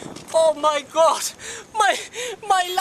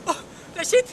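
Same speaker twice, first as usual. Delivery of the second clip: sobbing; 3 semitones higher, 4 dB quieter than usual. Acted. Tourist begging after bag stolen.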